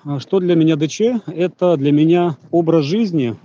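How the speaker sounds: usual speaking voice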